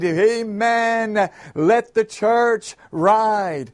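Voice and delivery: high, slow, stretched deacon's cry; excited shout. A narrator's reading. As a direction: holler it back, carried away in the spirit. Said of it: "began to run around and shouted".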